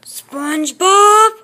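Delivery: loud, drawn-out shout; play-acted yell for SpongeBob; calling out. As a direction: holler the name, stretching it long.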